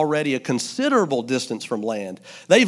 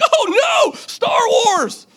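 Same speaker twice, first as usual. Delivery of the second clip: high shrieking cry of dismay over the ruined tape; hammed-up despair, voice flying up; mock horror at a chewed tape; mock panic; much slower than usual. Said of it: "And you're like".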